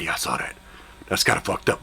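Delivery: whispered reply. Retold or imitated. Billy quietly judging the shot, whispered. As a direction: murmur it under the breath.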